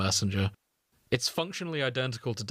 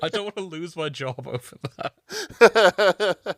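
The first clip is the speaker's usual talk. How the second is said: laughing protest; 6 semitones higher, 6 dB louder, slower than usual. Real, not acted. Talks while friend laughs.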